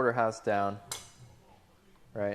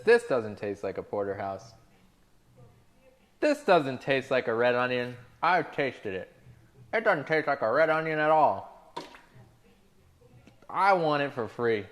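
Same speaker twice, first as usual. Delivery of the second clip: mock customer; 2 semitones higher, 4 dB louder than usual. Quoting complaining customer.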